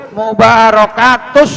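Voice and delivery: "Mubarakatus" ending in a long held s; tasydid on shad. Shown as a demonstration. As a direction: press and hold the final s of "Mubarakatus". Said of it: "Shotnya ada tasdid". ("Mubarakatus" dengan shad ditekan)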